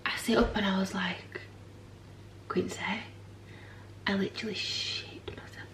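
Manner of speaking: breathy hushed whisper. Real, not acted. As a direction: drop to a whisper as the tension builds. Retelling the scary night moment.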